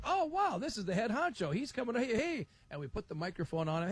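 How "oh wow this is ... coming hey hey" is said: high, thrilled hailing; excited. Retold or imitated. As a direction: gush over the big boss. Retold excitement at head honcho.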